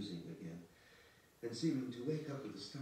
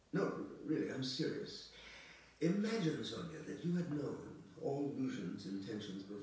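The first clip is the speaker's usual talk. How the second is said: earnest insistence; 5 semitones higher, 5 dB louder than usual. Acted. Earnest plea to Sonia in dialogue.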